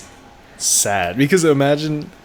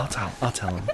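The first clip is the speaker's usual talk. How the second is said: softer, secretive aside; hushed aside at the lunch table; 9 dB quieter than usual. Retold or imitated. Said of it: "Guillermo's like".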